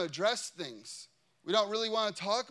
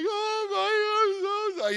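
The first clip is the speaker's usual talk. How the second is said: mock weeping; 12 semitones higher, 5 dB louder than usual. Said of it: "just weeping".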